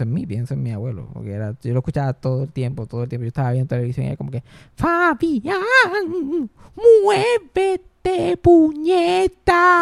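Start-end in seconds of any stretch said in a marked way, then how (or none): 4.7-9.8 very high, stretched nagging yell; abuela gritona. Acted. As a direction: imitate grandma yelling orders at her husband. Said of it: "con mi abuela diciendo Fabián". (abuela gritando, agudo y alargado)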